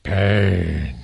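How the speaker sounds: very low, slow menacing single word; Mister T style low growl on "Pain."; Clubber Lang deep growl. Retold or imitated. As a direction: drop deep and say it like a boxer's threat. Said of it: "He goes".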